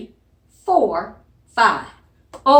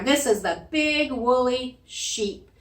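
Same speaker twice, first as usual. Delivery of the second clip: normal talking voice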